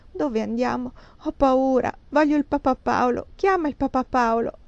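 put-on high, plaintive little-boy voice; whimpering child. A narrator's reading. Sleepy frightened boy asks for papa Paolo, whimpering. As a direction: whine like a puppy. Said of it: "guaiva come un cagnolino".

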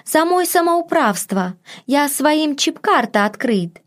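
put-on high broken northern accent; apologetic maid in dialect. A narrator's reading. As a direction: confess timidly like a foreign maid.

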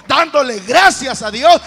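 loud preacher's exhortation; loud, fervent call to thanksgiving; impassioned preaching. Real, not acted. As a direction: build to a full-voiced pulpit cry.

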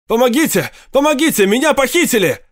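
громко, высоко, в панике; кричи изо всех сил, зовя на помощь; отчаянный крик о помощи. A narrator's reading. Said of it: "Он закричал". (отчаянный крик)

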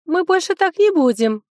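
butter her up sweetly. A narrator's reading. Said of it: "как можно более милым голосом".

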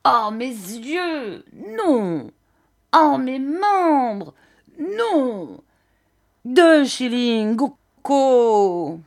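high, slow laments punctuated by cries; gusting singsong old man. A narrator's reading. Old man bargains down the price, high wailing singsong. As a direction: swell and fall on every drawn-out exclamation. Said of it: "toutes ses phrases sur une espèce d'air".

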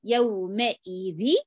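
يومئذ drawn out very slowly, kasra on the dhal, nun merged into the ya with ghunna; slow recitation of يومئذ; ghunna demo. Shown as a demonstration. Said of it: "أعمله كده".

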